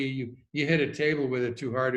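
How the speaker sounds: ordinary talk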